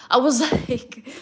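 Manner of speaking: real laugh mid-sentence. Real, not acted. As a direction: laugh through the words. Laughing at own story.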